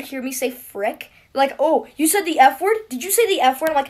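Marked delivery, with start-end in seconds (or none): 1.6-3.7 loud, high imitation of tattling kids; mock accusation. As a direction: pounce on it like a shocked tattletale. Classmates accusing her, acted out.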